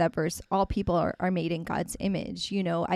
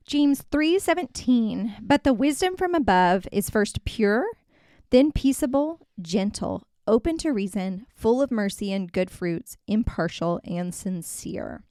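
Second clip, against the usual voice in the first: scripture reading; 3 semitones higher, 4 dB louder than usual. Acted. Reads Bible verse aloud.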